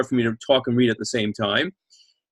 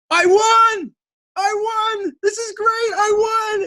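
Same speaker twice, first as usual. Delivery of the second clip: overjoyed shout; much slower than usual. Acted. Wedding guest finds winning bottle cap, shrieking with joy. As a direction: squeal like you just hit the jackpot.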